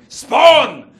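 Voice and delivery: huge, high-pitched mock command; sudden explosive yell, imitating a demanding mother; hammed-up shout. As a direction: bellow it as an impatient order. Mum urging sons to breed.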